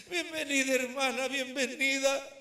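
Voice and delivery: mock mourner greeting a sister with a laugh; playfully hammed funeral host; mock condolence greeting. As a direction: welcome her with exaggerated sorrow.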